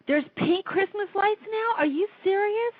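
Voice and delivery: light up at the news; thrilled surprise over pink lights; surprised. Real, not acted.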